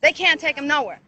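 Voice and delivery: ordinary talk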